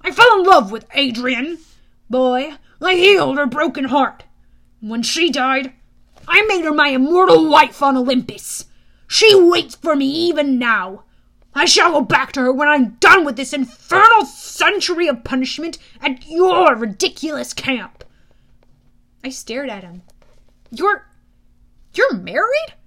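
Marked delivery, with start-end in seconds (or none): 0.0-18.0 loud, proud declaration; stern god, emphatic and loud; irritable god, bitter. Acted. 20.7-22.7 high, halting, rising question; stunned, louder, pitch leaping up; astonished. Acted. Hero astonished at god's revelation.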